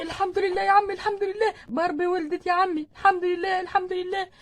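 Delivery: high, elated thanks; joyful relief. Acted. Husband telling father-in-law the birth is over, overjoyed. News that baby is born.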